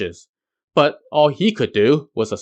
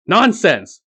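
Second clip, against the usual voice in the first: higher, louder scornful bark; 7 semitones higher, 3 dB louder than usual. A narrator's reading. Yellow Robe barking at Wukong's claim. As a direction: snap it to dismiss him. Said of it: "Yellow Robe barked".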